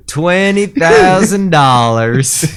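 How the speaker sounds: high, loud and slow, like a lover's confession; mock romantic confession, high and stretched; tender declaration. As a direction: swoon it out like a declaration of love. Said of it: "instead of saying, "I love you," they say".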